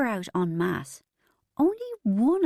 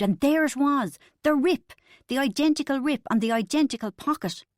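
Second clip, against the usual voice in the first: excited reveal; 6 semitones higher than usual. A narrator's reading. Narrator reaching the discovery of the rip, rising excitedly. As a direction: build to a triumphant climax.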